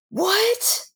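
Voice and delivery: gasp, then high disbelieving "what"; mock shocked gasp. Imitating suitors seeing the rose, mock shock. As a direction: act out stunned disbelief, pitch shooting up. Rejected suitors' stunned reaction acted.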